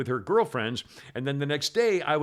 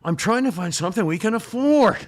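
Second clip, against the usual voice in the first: loud, higher protest; defensive husband; 7 semitones higher, 7 dB louder than usual. Acted. Husband answering wife about insurance, defensive protest. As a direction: protest back at full voice.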